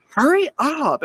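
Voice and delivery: put-on high, loud impatient little girl; mock yell. Little girl yells at a slow boy on the stairs, impatient yell. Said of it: "starts yelling at him".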